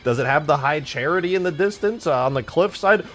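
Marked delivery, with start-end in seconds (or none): none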